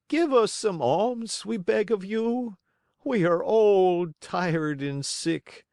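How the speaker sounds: high, slow whining plea; tearful begging. Acted. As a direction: lay on the misery to beg alms. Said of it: "in a tearful voice".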